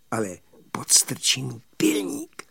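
whispered conspiratorial offer; hushed storyteller's whisper; secretive whisper. Acted.

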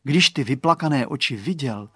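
ordinary delivery

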